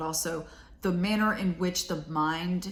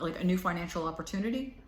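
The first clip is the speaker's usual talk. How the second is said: normal talking voice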